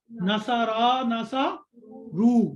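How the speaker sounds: ناسارا and NASA رو drawn out slowly; teacher slowly reciting words to be learned; slow recitation. Shown as a demonstration. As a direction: chant the words slowly for memorizing. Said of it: "ٹھیک ہے،".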